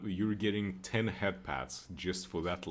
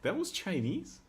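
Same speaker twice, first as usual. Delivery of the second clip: surprised; 12 semitones higher than usual. Real, not acted. Asked about the accent, incredulous.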